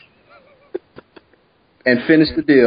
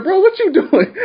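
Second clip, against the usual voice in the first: high, laughing question; laughing disbelief. Retold or imitated. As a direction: ask it while cracking up. Laughing quote to stranger.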